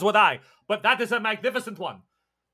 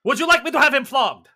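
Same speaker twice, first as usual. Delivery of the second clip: pompous tyrant; 5 semitones higher, 7 dB louder than usual. Acted. GM voices tyrant offering punishment.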